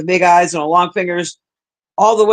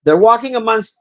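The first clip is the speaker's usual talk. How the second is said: ordinary talk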